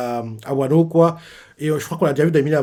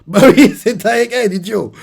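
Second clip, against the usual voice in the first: higher and louder through a laugh; laughing jab; 7 semitones higher, 7 dB louder than usual. Pretend. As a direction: tease him while laughing. Laughing mock answer to Luffy.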